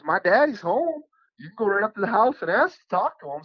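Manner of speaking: high, bright voice for the farmer's daughter, a bit quick; imitating the young woman. The girl in the truck tells the hunter to ask her dad, in a high girlish voice. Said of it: "she goes".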